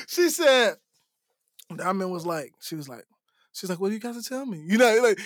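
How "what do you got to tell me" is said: hushed, wary question; imitated wary voice. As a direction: ask it softly, imitating a suspicious girlfriend. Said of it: "when they get that, that, that, that voice".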